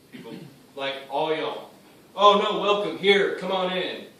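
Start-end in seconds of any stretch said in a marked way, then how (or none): 2.1-4.1 loud, high, gushing greeting; exaggerated singsong hospitality; mock welcome. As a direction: parody an over-friendly churchgoer. Mocking churchgoers' welcome.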